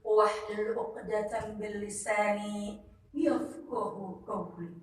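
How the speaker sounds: slow, measured Arabic opening prayer; prayer recitation. Acted. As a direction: recite the opening du'a calmly and slowly. (membaca doa pembuka dengan pelan dan khusyuk)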